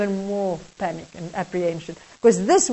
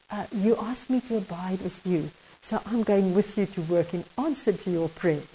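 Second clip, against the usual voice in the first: softer, calm, tender voice of Jesus; gentle Lord Jesus; 4 semitones lower, 8 dB quieter than usual. Acted. Jesus gently tells the man he'll come to work, softly. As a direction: speak it gently, like a patient friend.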